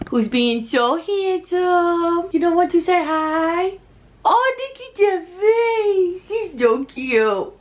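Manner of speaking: high, drawn-out gushing coo. Real, not acted. Talking to her adorable boy on camera, cooing baby talk. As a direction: squeal adoringly, stretching the words out.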